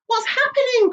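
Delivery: high, loud startled question; alarmed cry. Acted. James waking in alarm as the room heaves, crying. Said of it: "cried James".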